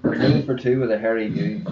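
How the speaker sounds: ordinary delivery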